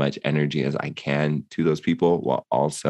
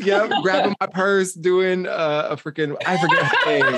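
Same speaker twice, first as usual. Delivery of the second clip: laughing; 12 semitones higher, faster than usual. Real, not acted. Laughing, acting out leaving.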